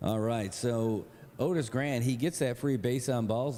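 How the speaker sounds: usual speaking voice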